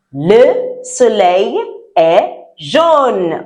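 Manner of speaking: "Le soleil est jaune" said very slowly and clearly, stress on "jaune"; slow model sentence. Shown as a demonstration. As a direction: spell out "Le soleil est jaune" for the class to echo. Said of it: "Repeat after".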